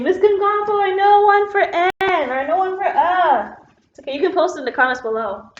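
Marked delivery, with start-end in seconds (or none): 0.0-3.5 mock a student calling out for the teacher, pitched way up; yelling pupils. Students at home yelling answers through the screen, high imitated call-out. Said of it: "you're yelling at me through the screen".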